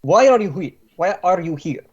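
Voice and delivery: put-on brusque Slavic-accented interrogation. Boss interrogates captive Stryker, impatient Slavic accent. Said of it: "pretty good Slavic ac-, accent".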